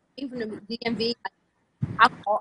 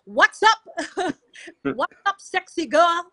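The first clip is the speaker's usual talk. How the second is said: imitating her brother; 7 semitones higher, 6 dB louder, slower than usual. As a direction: ham up a cheeky flirt calling out. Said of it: "he'd be like".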